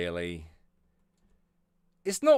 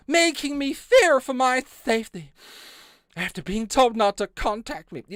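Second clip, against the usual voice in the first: Karen voice; 10 semitones higher, 5 dB louder than usual. Retold or imitated. Mocking the complainant's letter in a Karen voice. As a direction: whine it like an outraged Karen, much higher. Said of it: "get my Karen voice on".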